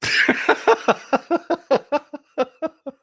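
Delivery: high, helpless burst of laughter; long high cackling laugh; real hard laugh. Real, not acted. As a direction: let a real laugh break out at the accusation. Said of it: "I had to laugh so fucking hard".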